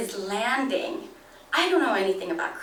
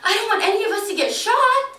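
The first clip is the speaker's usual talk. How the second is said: panicked hush; 8 semitones higher, 3 dB louder than usual. Retold or imitated. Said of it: "I panic".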